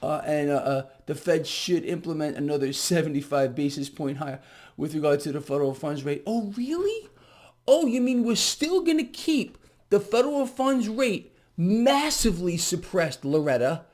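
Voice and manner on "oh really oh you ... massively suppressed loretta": high, mock-innocent questioning; sarcastic. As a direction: sneer it as fake surprise. Sarcastic jab at Fed official.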